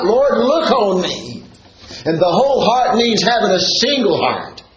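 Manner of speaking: high, slow, pleading preacher's call; fervent preaching. Real, not acted. Pastor praying aloud for the flock, slow and lifted. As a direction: plead with God before the church.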